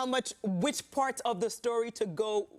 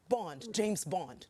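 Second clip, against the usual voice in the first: Bond line; 4 semitones lower, 4 dB quieter, much slower than usual. Pretend. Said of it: "Try saying it".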